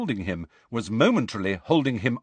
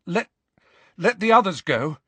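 higher, urgent plea with a false start; pitched-up, desperate bargaining; 7 semitones higher, 4 dB louder, faster than usual. A narrator's reading. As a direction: plead desperately, stumbling on the first word. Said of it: "said Harry desperately".

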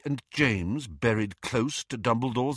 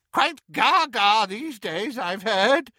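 cheerful; 12 semitones higher, 5 dB louder, slower than usual. A narrator's reading. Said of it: "said Auntie Muriel cheerfully".

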